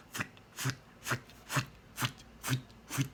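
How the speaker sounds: soft whispered foot, foot; machine noise. Acted. The shiny machine going, soft breathy puffs. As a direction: puff it out like a little machine. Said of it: "kept going".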